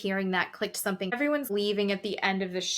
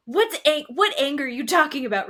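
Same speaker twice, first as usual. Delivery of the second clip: loud, higher indignant retelling; mock yelling; 4 semitones higher, 6 dB louder than usual. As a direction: imitate a heated, yelled denial. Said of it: "As he's screaming and yelling at her".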